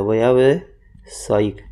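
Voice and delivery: व्यावसायिक drawn out very slowly, syllable by syllable; slow syllable drill. Shown as a demonstration. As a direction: spell व्यावसायिक out slowly for the class. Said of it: "ध्यान रखना". (व्यावसायिक को बहुत धीरे, अक्षर-अक्षर बोलें)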